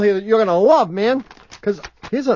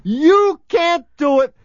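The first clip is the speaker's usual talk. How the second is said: slow mock imitation; 7 semitones higher, slower than usual. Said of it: "That's what you said".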